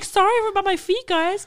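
high, loud sing-song apology; hammed-up sheepish apology; mock apologetic friend. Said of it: "she'd be like".

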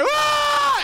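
long high mock scream; high, held cry; labor scream. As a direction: wail in labor pain. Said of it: "She's like".

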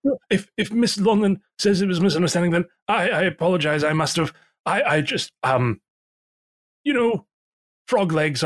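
high, anxious innkeeper voice; nervous innkeeper. Acted.

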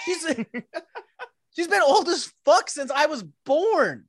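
cracking up while landing the joke; laughing. Real, not acted. Laughing at her own joke.

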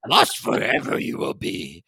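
breathy, whispered little alien sage; Yoda voice. Retold or imitated. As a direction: hush it like an old swamp master. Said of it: "You sounded like Yoda there".

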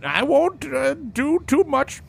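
put-on high old prospector voice. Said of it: "that's old prospector voice".

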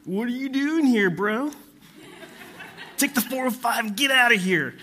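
put-on high laid-back surfer dude accent; mock Californian bro accent.

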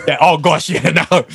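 giggling as you give up; laughing. Real, not acted. Laughing retelling exhaustion.

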